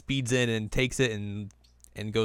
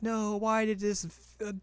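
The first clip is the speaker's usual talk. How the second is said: high whiny Tsubaki; 11 semitones higher than usual. Retold or imitated. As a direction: whine like a sore loser witch. Voicing defeated Tsubaki.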